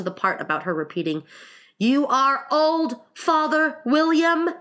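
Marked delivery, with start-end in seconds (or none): 1.8-4.5 high, loud, slow declaiming; recitation. Acted. Alice repeats verse to the caterpillar, loud recitation. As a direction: declaim each word like a schoolroom verse. Quoting the recited poem title.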